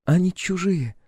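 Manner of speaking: high female character voice; Clementine. A narrator's reading. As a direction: pitch it up as the friend's girlfriend. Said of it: "указала пальцем на иллюминатор подружка Клауса".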